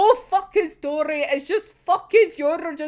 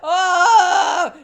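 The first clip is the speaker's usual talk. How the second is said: loud drawn-out exasperated "ah"; drawn-out loud groan; exasperated cry; 6 semitones higher, 10 dB louder than usual. Real, not acted. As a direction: let out a long, loud wail after laughing. Cry out after laughing at coincidence.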